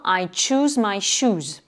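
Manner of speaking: slow, careful "I choose my shoes", tch in "choose", sh in "shoes"; careful English pronunciation; English demo. Shown as a demonstration. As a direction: spell out each sound slowly. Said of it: "la même confusion entre le son che et le son che".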